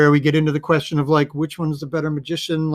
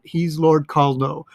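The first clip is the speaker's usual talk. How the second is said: ordinary talk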